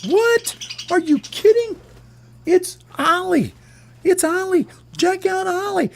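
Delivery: very high, squeaky cartoon birds; the gang spotting Ollie on the pipe, in very high, squeaky voices; amazed bird voices. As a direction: squeal it like stunned little birds. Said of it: "And they're like".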